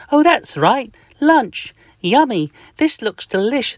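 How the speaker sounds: high, pleased tiny-creature voice; cheery itch at lunch. Acted. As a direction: relish your lunch out loud, squeakily.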